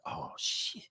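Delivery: hushed whispered dread; a parent whispering in dismay. Acted. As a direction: breathe it out in quiet alarm. Parent dreading kid's love.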